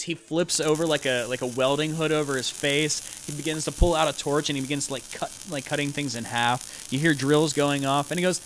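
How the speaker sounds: ordinary talk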